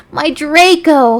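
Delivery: slow, high, loud wail; moaning. A narrator's reading. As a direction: moan out the name. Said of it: "he moaned".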